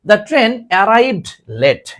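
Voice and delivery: very slow, each word drawn out; teacher reading the example slowly; slow model read. Shown as a demonstration. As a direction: read the sentence slowly to the class. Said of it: "এই দেখো".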